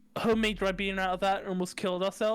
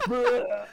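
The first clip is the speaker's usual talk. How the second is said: mock retch; 5 dB louder than usual. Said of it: "I was like".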